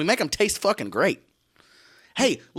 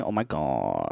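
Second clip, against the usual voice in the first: imitating Gina; 14 semitones lower, 3 dB quieter, much slower than usual. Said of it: "she loves saying".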